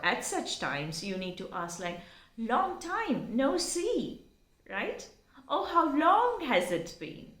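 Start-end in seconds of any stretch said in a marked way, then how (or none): 2.4-4.3 high, drawn-out delighted greeting; happy greeting. Shown as a demonstration. Greeting somebody met after a long time, happy. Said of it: "a right way to express your happiness". 5.5-7.3 high-pitched, pleased reunion question; happy to meet. Shown as a demonstration. Asking someone met after a long time, happy. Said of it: "a right way to express your happiness".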